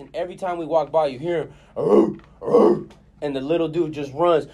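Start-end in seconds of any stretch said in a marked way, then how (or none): 1.8-2.8 gruff mock barking, a bit louder; dog bark imitation. Telling friends about the neighbor's dog, mock barking. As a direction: bark like a big apartment dog.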